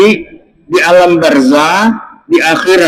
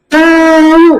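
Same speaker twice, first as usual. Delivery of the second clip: high, drawn-out mimicked reply; clueless "dunno"; 11 semitones higher, 3 dB louder than usual. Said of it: "Nah, gitu". (menirukan jawaban "tau" melengking panjang)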